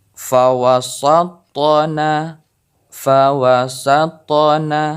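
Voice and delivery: very slow "fawasatna" with the t bouncing; qalqalah on ta. Shown as a demonstration. Teacher demonstrating qalqalah, slow bounced ta. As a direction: let the ta in "fawasatna" echo. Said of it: "Contoh kedua".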